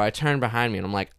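ordinary delivery

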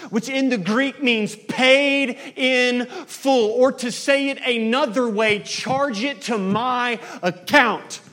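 loud, high, slow sermon build; climactic preaching. Acted. Preacher tells the congregation of Jesus' death, rising loudly. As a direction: drive it home with full force.

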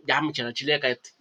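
low, soft telling-off; hushed scolding. Retold or imitated. Friend tells drunk Michelle to stop talking, hushed scolding. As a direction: act out quietly shutting a friend up.

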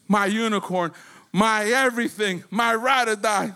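ordinary delivery

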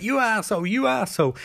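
high-pitched retold insult; mock fury. Caitlin hitting Cisco, mock furious.

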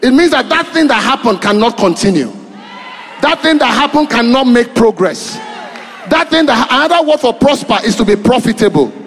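fiery preaching. Acted. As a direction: preach it out to the congregation. Preaching at full force.

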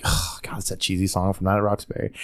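soft breath of dismay; mock dismay. Host recalling first hearing the cheesy song, soft dismay. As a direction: groan it softly under the breath. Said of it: "you're like".

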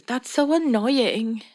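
put-on childhood English quote. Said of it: "eu disse".

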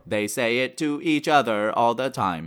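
robotic synthesized speech, evenly paced; computer voice. Acted. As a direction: speak like a talking computer.

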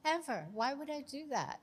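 high, loud and emphatic; emphatic exclaim. Real, not acted. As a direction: push it up with disbelief. Exclaiming she'd never read it.

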